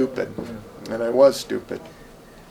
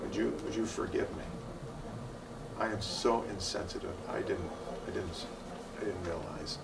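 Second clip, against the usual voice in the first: much softer, lower, humble apology; 5 semitones lower, 15 dB quieter than usual. Retold or imitated. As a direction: plead forgiveness gently, almost hushed. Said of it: "I came up to him and I said".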